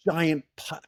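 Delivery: ordinary talk, nothing marked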